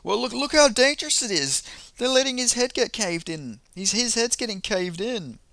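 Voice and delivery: high, indignant mock outrage; mock outraged critic. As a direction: ham up a critic pointing at the danger. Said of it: "he's just like".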